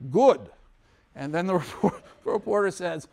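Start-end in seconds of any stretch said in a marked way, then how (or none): 0.1-0.5 curt Khrushchev. Retold or imitated. Khrushchev's curt one-word answer.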